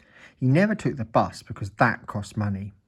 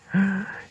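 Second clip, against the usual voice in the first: dramatic gasp; 10 semitones higher than usual. A narrator's reading. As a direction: gasp in horror. Ears become tomatoes.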